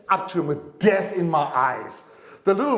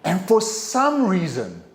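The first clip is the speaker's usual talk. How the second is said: usual speaking voice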